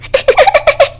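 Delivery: high giggling burst; girlish giggle. Acted. Girls giggling at the cat in a box, high laugh. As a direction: giggle like a little girl. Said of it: "the girls burst into giggles".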